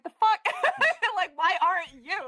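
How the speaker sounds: indignant retort. Retold or imitated. Retorting to coworkers who mock her.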